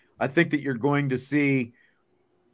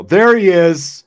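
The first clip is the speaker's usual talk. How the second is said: drawn-out, loud and higher "there he is"; excited greeting; 6 semitones higher, 14 dB louder, slower than usual. Real, not acted. Host spots the escaped puppy on camera, excited. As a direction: greet the runaway dog warmly and loudly.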